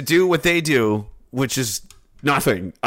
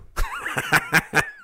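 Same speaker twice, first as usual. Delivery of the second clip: high giggle. Real, not acted. High giggle after insult.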